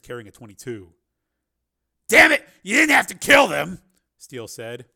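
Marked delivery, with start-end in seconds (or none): 2.1-3.8 high, loud angry shout; furious raised voice, high and hard-hit; angry outburst. Acted. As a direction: shout in outraged protest. Angry protest at the killings.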